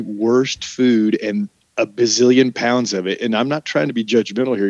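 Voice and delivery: usual speaking voice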